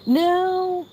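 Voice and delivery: very high playful "no". Real, not acted. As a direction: tease the cat in a squeaky voice. Playful reply to cat.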